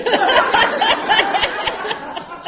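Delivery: big loud high laugh. Real, not acted. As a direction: burst out laughing. Laughing at own story.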